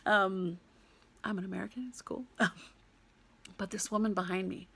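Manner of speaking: ordinary delivery, nothing marked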